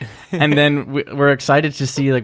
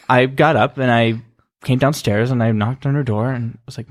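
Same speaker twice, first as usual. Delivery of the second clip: normal talking voice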